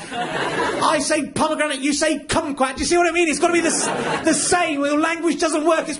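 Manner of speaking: high-pitched, worked-up comic tirade; exasperated rant. Acted. Comic berates the man who says potato wrong, exasperated. Acted rant over one mispronounced word.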